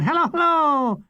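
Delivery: high mock policeman's hello; a lightly voiced comic policeman. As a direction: play a comic bobby. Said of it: "he repeats in a police voice".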